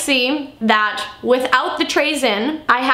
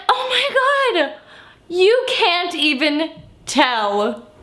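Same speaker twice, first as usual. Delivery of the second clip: much higher, slow, sugary mock praise; over-sweet, drawn-out sarcastic reassurance; mock gushing; 4 semitones higher, slower than usual. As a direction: parody the assistant's fake enthusiasm. Mocking assistant's fake reassurance.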